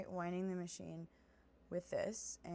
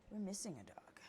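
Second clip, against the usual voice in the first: normal talking voice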